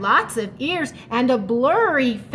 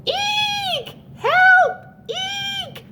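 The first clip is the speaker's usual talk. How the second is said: terrified squeaks; much slower than usual. Acted. Little Mouse fleeing invisible monster, squeaking. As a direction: shriek like a tiny scared mouse. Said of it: "squeaked little Mouse".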